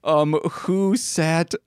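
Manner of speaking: slow, drawn-out words while looking something up; slow searching. Real, not acted. Host fact-checking a story mid-show, slow and halting. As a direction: say it piece by piece, as if typing it.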